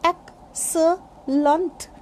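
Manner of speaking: excellent broken up slowly as ek, suh, lunt; syllable by syllable. Shown as a demonstration. Teacher breaking down excellent for learners, slow separate syllables. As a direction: pause between each syllable of excellent. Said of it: "this is".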